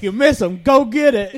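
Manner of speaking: loud, high mock command; teasing order. Teasing the waitress about the smudge, loud mock order. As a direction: boss her around as a joke. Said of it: "I was like".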